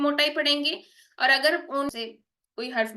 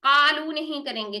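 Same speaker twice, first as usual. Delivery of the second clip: ordinary talk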